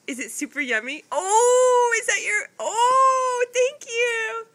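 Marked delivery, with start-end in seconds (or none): 1.1-4.5 delighted squeal. Real, not acted. Baby offers watermelon, parent delighted.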